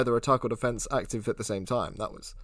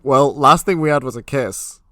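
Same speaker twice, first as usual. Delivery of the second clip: usual speaking voice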